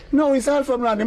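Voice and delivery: high, firm insistence; lady insisting to Clive, high and firm; insistent lady. Retold or imitated.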